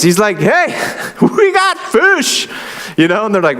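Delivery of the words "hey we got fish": calling out. Retold or imitated. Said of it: "Then he shout for his buddies".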